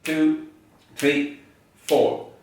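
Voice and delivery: firm, evenly spaced count. Shown as a demonstration. Teacher leads into example two, firm count-in. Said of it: "Goes like this".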